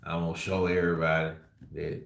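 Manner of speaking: low, soft lazy-tongue mumble; lazy Texas drawl. Retold or imitated. Vlogger imitating the fighter's lazy Texas speech. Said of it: "lazy tongue like this".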